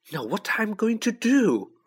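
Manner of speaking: high-pitched, upset question; pitched right up in dismay; shrill. A narrator's reading. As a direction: ask it in a shrill voice. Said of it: "she asked shrilly".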